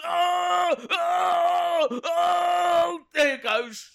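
high, squeezed voice of huge effort; mock straining. Henchman rolling body into grave for boss, hammed-up straining. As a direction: groan and wail through a heavy push. Acts struggling to roll body in.

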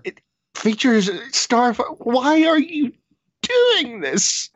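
high, pleading outrage; why would you, to the heavens; exasperated disbelief. Real, not acted. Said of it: "that anger I mentioned".

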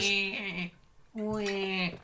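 gargling. Pretend. Gargling water on stage.

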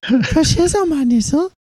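high, squeaky [name] voice; [name] impression. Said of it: "we need [name] back".

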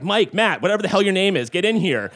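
parents yelling. Retold or imitated. Parents calling the kids in, mock yell. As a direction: holler across the house like a parent. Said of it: "my parents being like".

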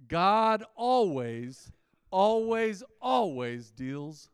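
slow, loud, each always hammered; drawn-out preacher emphasis; emphatic always. Real, not acted. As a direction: pound out every always. Preacher hammering his first point.

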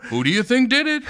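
high loud mock voice; put-on caricature voice. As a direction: keep the silly boast voice going. Bit continues.

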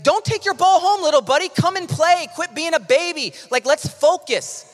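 high, loud mock scolding; tough-love pep talk. Preacher talking to himself, tough-love pep talk. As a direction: chivvy a sulking kid back into the game. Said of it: "that's how I talk to myself".

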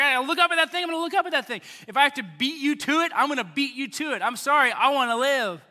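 hammed-up urgent insistence. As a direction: act out racing someone for your life. Bitten person racing to see serpent.

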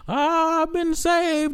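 high, drawn-out preacher cry; Martin Luther King. Acted. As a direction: proclaim it slowly to a congregation. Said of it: "on some like Martin Luther King".

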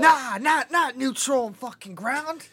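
put-on high, loud Southern old-timer; Southern grandpa. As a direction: put on an older Southern man's scolding. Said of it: "Damn, when he becomes Southern".

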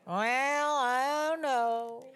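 slow, mopey donkey drawl; Eeyore impression. Preacher mimics Eeyore to show his constant whining, slow and mopey. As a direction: sigh it out like a gloomy old donkey. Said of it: "Winnie the Pooh Eeyore".

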